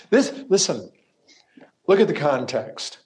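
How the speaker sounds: usual speaking voice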